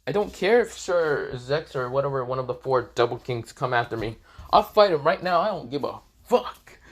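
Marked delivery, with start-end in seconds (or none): 4.5-6.8 loud, unflinching defiance; bold, no hesitation at all; defiant. Acted. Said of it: "says it without even stuttering a bit".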